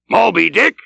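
high, startled captain's shout; shouted question. Acted. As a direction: shout the name back as a question. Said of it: "shouted Ahab".

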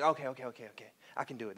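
low, hushed self pep talk; acted self-reassurance. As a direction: drop it right down, calming yourself. Son calms himself after overdoing it.

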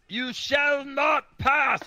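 booming wizard shout, high and slow; Gandalf voice. Acted. VR friend asks for role play, bellowing Gandalf shout. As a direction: thunder it out like Gandalf at the bridge. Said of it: "I need to get my Gandalf voice down".

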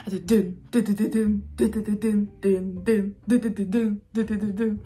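imitating a bass drum beat; beatboxing the beat. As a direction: vocalize the song's beat. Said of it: "みたいな。".

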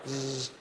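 quiet electric whirr; buzzing wheelchair noise. Retold or imitated. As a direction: hum it like a mobility scooter. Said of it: "My impression of".

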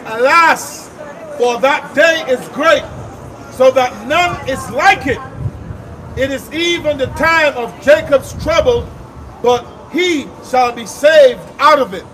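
grave, drawn-out scripture reading; solemn scripture reading. Acted. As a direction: proclaim it slowly, like from the pulpit.

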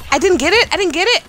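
high, repeated cry of dismay. Real, not acted. Player just missed the play, high dismayed blurt. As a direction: blurt it out high, upset at yourself. Botched ability in game.

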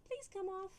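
a high little plea; witch pleading. Acted. Winnie asking her cat to get off the bed, high and pleading. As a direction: ask the cat nicely to get off. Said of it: "She's probably telling him".

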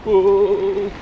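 jolted on a bumpy ride; on a bumpy road. Real, not acted. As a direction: voice shaken by the bumps.

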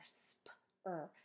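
"sp-er" as separate letter sounds; sounded-out blend. Shown as a demonstration. Teacher showing a three-letter blend, each sound separate. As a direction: sound out each consonant of "sp-er" on its own. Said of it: "They each make their own sound".

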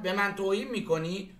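usual speaking voice

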